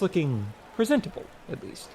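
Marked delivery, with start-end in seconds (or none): none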